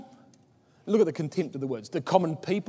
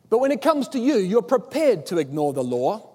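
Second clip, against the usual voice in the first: much higher, louder sudden rise; pointed accusation; 5 semitones higher, 6 dB louder than usual. Retold or imitated. Nicodemus confronting the council, higher and louder accusation. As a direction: press the point on the hypocrites. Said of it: "he says".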